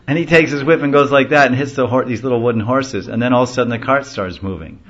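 ordinary talk

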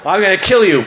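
loud, high-pitched comic menace; hammed-up threat from a fearless bull; mock threat. Bull demon to Krishna while charging, mock threat. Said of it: "He was going".

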